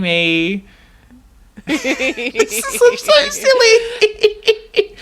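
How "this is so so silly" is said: high, slow, laughing through it; giggling at the silly prank; prank reveal laughing. Real, not acted. April Fool's prank reveal.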